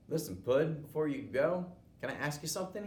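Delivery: loud, high mock-friendly taunt; sweet-talking bully. Bully cornering the boy.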